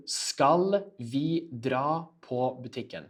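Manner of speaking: slow, word-by-word delivery. Shown as a demonstration. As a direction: say it slowly, each word separated for learners. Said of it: "I'll say it f-, uh, slower to you".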